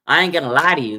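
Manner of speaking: firm, loud quote of the player; quoted catchphrase. Retold or imitated. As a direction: lean into the catchphrase. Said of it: "that's his saying".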